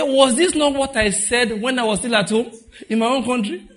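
Jonah, laughing in mock outrage; indignant Jonah. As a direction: protest like an offended prophet. Jonah complaining to God.

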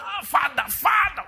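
high, loud mock yell of Father; imitating a shouted prayer. Young man drowning out God with a shouted prayer, loud hammed-up. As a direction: scream it like a frantic prayer warrior. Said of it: "he was shouting".

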